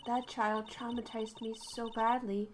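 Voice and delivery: quiet resolve, inward; inner monologue. A narrator's reading.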